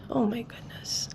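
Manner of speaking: breathy dismayed whisper; whispered aside. Real, not acted.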